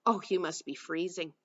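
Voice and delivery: ordinary delivery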